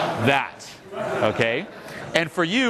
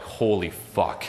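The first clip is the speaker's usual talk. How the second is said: stunned dread; 9 semitones lower, 4 dB quieter, much slower than usual. Retold or imitated. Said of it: "you're like".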